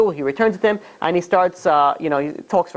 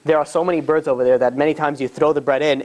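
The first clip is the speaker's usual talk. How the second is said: ordinary delivery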